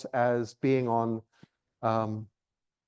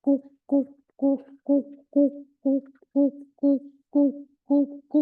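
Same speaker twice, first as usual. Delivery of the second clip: much higher rapid coo, coo series; yellow-billed cuckoo call; 13 semitones higher, 4 dB louder than usual. Shown as a demonstration. Birder showing friends the cuckoo call, high coo series. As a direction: pipe a yellow-billed cuckoo's run of coos. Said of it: "Yellow-billed cuckoo goes".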